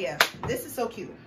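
ordinary delivery

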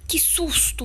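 sudden high gasp of fright; startled. Real, not acted. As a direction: jump scare yelp. Startled by the killer in game.

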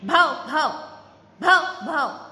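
loud barking woof; dog bark. Shown as a demonstration. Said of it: "A dog says".

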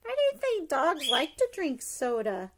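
usual speaking voice

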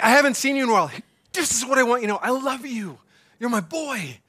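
high-pitched, emphatic fatherly love; effusive affection. Retold or imitated. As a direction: re-enact gushing love for your son. Re-enacting emphatic love for son.

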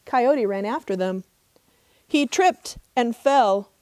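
ordinary delivery

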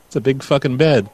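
normal talking voice

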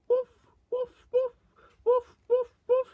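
high-pitched pretend woofs; imitated dog bark. Barking like a dog between remarks, high imitated woofs.

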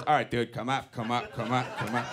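low, soft repeated directions; low-pitched parking helper patter; guiding a driver in. Retold or imitated. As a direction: imitate a guy coaching someone into a parking spot. Voicing Jerry waving a car in.

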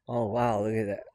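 ordinary talk

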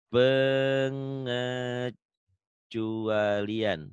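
Pengecualian spelled out very slowly, syllable by syllable; slow dictation. Shown as a demonstration. A teacher dictating Pengecualian very slowly to his student. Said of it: "Pak Ali tulis di sini".

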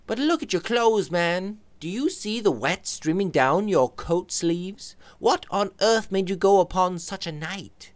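Robert's voice. Acted. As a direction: fuss over his wet clothes.

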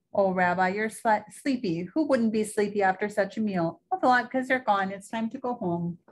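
a hint of a sweet old grandmother; old Baba Brana. Baba Brana fusses over her guest's coat, lightly voiced old woman.

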